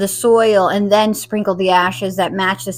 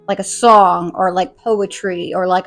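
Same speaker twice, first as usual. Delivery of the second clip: usual speaking voice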